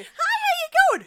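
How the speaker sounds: put-on high squeaky cute girl voice; man doing a woman's voice. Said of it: "Rosie O'Donnell with a Pack-A-Day habit".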